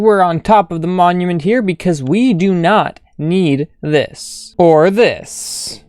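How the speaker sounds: walk the viewers through the build; video narration. Acted.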